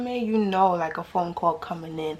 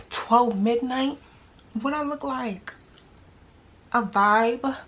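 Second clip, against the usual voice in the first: incredulous; 3 semitones higher, slower than usual. Real, not acted. Outraged at late-night text.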